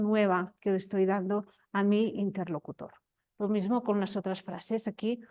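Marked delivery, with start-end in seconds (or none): none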